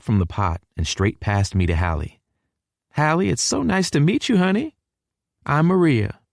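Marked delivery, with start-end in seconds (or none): 2.9-6.2 high-pitched Texan mother voice; effusive southern hostess, pitched up; welcoming southern mama. A narrator's reading. Mama welcomes son's girlfriend.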